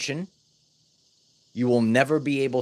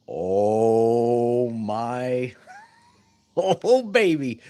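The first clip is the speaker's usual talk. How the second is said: laughing delight; much slower than usual. Real, not acted. Teacher excited about the lesson's key part, laughing. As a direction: relish the big moment with a laugh.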